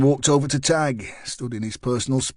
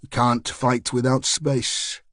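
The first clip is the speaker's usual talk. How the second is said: usual speaking voice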